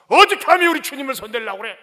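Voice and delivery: loud, indignant threat; how dare you, shouted; angry challenge. Retold or imitated. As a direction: snap it out as if drawing a sword. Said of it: "하면서 말이죠".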